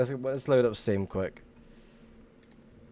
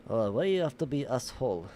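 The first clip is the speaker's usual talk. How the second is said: put-on light Russian accent, broken English; 2 semitones higher than usual. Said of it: "I like to speak with a Russian accent".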